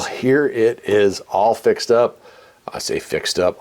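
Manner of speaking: usual speaking voice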